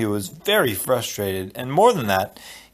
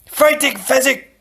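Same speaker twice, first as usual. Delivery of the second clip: urgent shout; 13 semitones higher, 5 dB louder than usual. Acted. Inigo urges Fezzik.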